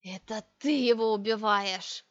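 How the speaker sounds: put-on slow old witch's hiss; hissed accusation. A narrator's reading. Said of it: "и прошипела".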